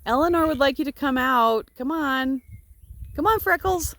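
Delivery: calling out. Real, not acted. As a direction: urge them out of the barn. Calling sheep out of barn.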